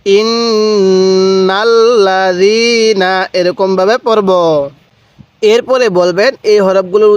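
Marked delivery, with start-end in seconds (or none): 0.0-3.3 long stretched syllables; slow recitation. Shown as a demonstration. Teacher modelling the joined word slowly. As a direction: hold each sound out. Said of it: "আরেকবার".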